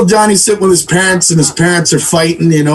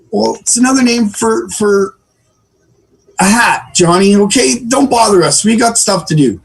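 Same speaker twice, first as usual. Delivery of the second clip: higher-pitched, stalling mock parent; flustered parent; 6 semitones higher than usual. Mom making up an answer for Johnny, flustered parent voice. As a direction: fumble an excuse, then shoo the kid. Parent fobs off curious child.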